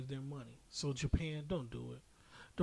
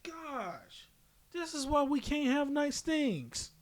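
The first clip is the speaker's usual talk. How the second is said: much higher, pushed louder; exasperated lament; 12 semitones higher, 5 dB louder than usual. Real, not acted. Fed-up host laments the rebrand to his co-host, exasperated. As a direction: groan it to the heavens.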